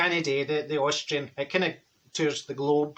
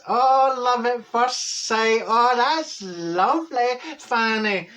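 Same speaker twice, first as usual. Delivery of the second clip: slow, higher, drawn-out cooing; mock gushing; 6 semitones higher, 3 dB louder, much slower than usual. Reacting to Fanny's love at first sight, mock gushing. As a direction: coo over it, stretching the words out. Gushing response to friend's romance.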